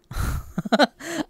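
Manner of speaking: a sudden high-pitched giggle; high squeaky laugh. Real, not acted. Laughing at his own rude reply, high squeal.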